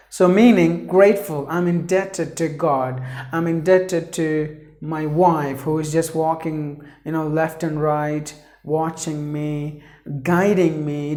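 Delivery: ordinary talk, nothing marked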